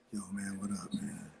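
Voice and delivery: low, hushed, breathy whisper; hushed low impression of a famous producer. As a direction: whisper it low like a mogul on the phone. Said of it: "that [name] sounding voice".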